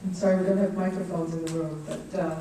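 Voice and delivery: ordinary delivery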